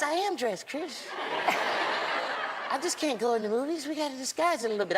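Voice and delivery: gentle high explaining; Michael explaining his disguise. Retold or imitated. Voices Michael.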